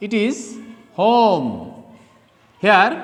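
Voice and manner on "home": "home" with an audible H at the start; sounded H. Shown as a demonstration. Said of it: "H is pronounced।".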